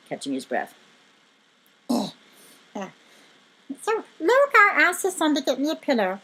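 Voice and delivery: ordinary delivery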